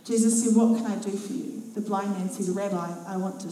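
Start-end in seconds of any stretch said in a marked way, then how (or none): none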